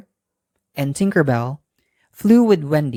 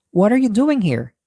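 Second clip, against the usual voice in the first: lightly voiced Wendy, raised pitch; higher girl's question; 7 semitones higher than usual. Wendy asking the strange boy at the window, curious. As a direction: ask it up high, curious. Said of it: "Wendy asked".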